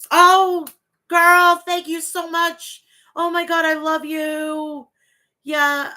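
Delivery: shower them with thanks; gushing thanks. Real, not acted. Thanking a viewer in the chat, gushing high and loud.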